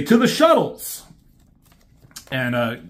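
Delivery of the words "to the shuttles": high, loud battle cry; rallying shout. Retold or imitated. Decepticons calling their troops to the shuttles, loud high shout. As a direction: yell it out to the troops.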